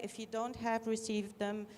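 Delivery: ordinary talk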